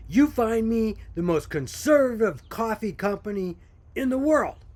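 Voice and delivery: retold angry husband, voiced high and loud; mock livid demand. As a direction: imitate an outraged husband barking an order. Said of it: "he was livid".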